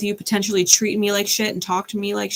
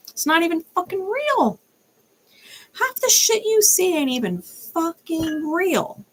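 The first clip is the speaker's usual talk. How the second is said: scornful; 10 semitones higher, slower than usual. Real, not acted. Mocking social media fakery.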